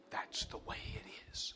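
slow soft whisper, accepting fate; whispered resignation. Real, not acted. Preacher accepting family loss, slow whisper. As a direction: breathe it out, resigned and quiet.

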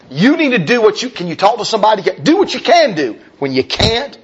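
high, urgent preaching; exhorting. Acted. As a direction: urge them on, pitched high.

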